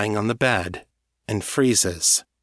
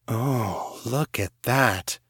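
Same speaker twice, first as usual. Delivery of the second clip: appreciative; much slower than usual. A narrator's reading. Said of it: "I say appreciatively".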